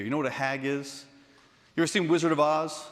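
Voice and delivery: usual speaking voice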